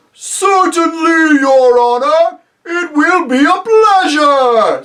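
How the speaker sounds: high, very loud, slow giant voice; obliging giant. Acted. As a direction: boom it out eagerly and slowly. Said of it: "said Giant Rumblebuffin".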